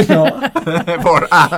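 bursts into loud laughter at her own joke; laughing. Real, not acted. Singer laughing with the host about her parents crying. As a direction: laugh it off loudly. Joking parents cried at bad singing.

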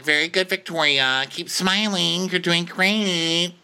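high, slow singsong praise; encouraging onlooker. Retold or imitated. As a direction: coo approval from afar, stretching the words. Mimicking Anya watching through opera glasses.

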